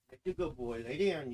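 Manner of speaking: ordinary talk, nothing marked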